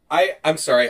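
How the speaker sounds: ordinary delivery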